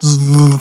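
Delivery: imitated buzzing wing noise; hummingbird buzz. Shown as a demonstration. Game master shows hummingbird flying near player, buzzing. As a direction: buzz like a tiny bird zipping past.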